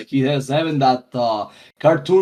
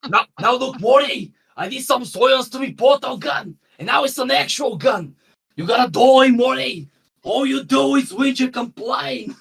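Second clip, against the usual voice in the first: Australian accent, cartoon grandfather, much higher; 9 semitones higher than usual. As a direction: do a broad Australian mad scientist scolding Morty. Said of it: "That was a pretty good accent".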